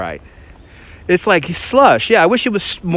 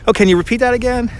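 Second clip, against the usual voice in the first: much higher, quick retold question; retold polite request; 8 semitones higher, faster than usual. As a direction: imitate asking a repeat, pitched up and brisk. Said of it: "I had to constantly remind her".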